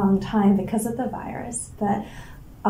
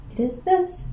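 much higher, with a rising finish; presenter's retake; 9 semitones higher than usual. Acted. Actor redoes her line for the camera, high and rising. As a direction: lift it high for a presenter's reveal. Retake after asking where to point.

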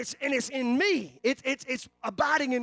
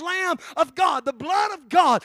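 loud, ringing preacher's cry of warning; full-force preaching; 4 semitones higher, 9 dB louder than usual. Acted. Pastor warning the congregation, preaching loud at full force. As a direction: preach it hard, like a warning from the pulpit.

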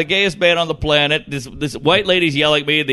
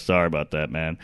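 much lower, softer apology; deep mumbled sorry; 12 semitones lower, 8 dB quieter than usual. Retold or imitated. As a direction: mumble it low and sheepish.

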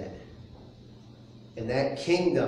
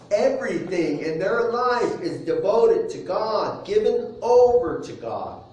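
raised, high-pitched preacher's delivery; emphatic preaching; 7 semitones higher, 6 dB louder than usual. Real, not acted.

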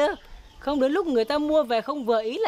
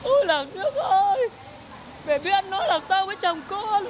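wailing for help; 7 semitones higher than usual. Acted. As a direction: cry out to the neighbours over the lost money. Lost money, wailing to the village. (than khóc kêu làng nước)

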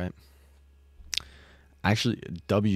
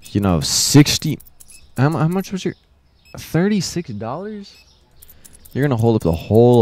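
sarcastic disbelief; 6 semitones higher, 5 dB louder than usual. Acted. Mocking slow customer's payment.

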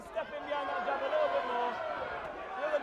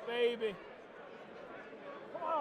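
ordinary talk, nothing marked

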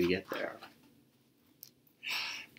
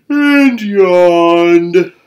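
yawning; 6 semitones higher, 8 dB louder than usual. A narrator's reading. Narrator acts the mule's yawn.

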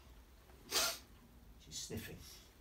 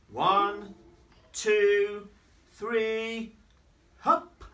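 louder count rising to a high hop; building count; 7 semitones higher, 9 dB louder, much slower than usual. Acted. Babies counting Lulu into a leap, rising excitement. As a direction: count up and launch.

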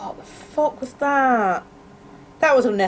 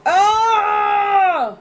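sudden loud startled cry; startled shout; 10 semitones higher, 6 dB louder than usual. Real, not acted. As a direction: jolt in real fright. Jump scare shout.

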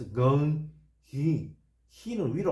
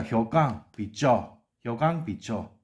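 slow demo reading; 4 dB louder, much slower than usual. Shown as a demonstration. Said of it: "뭡니까".

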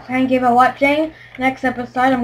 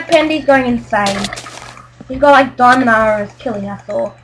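usual speaking voice

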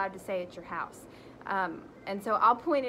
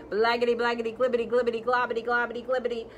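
silly gibberish; 2 semitones higher, 7 dB louder, faster than usual. Acted. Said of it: "makes silly sounds like".

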